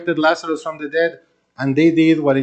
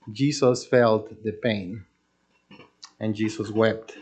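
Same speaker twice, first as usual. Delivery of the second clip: soft solemn; 4 semitones lower, 6 dB quieter, slower than usual. Acted. Tender moment, softer slower.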